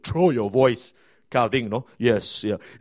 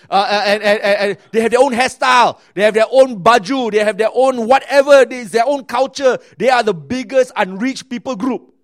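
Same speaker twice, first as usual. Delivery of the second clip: rising shout; 7 semitones higher, 10 dB louder than usual. Real, not acted. Preaching about youth as a tribe.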